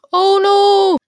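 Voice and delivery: loud, high mock alarm; exclaiming at her own harsh words. Real, not acted. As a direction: yelp of dismay.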